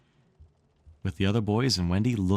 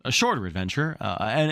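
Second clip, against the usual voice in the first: plain reading voice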